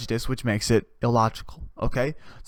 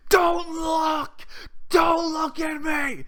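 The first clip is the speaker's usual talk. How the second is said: very high, strained yell; high screamed demand; much slower than usual. Retold or imitated. Driver honking at bus passengers, screaming at them. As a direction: shriek it in outrage. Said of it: "I honk my horn till they look at me and I go".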